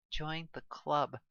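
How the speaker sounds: soft, slow wry remark; dryly quiet; quiet aside. A narrator's reading. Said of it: "Sonya mentioned".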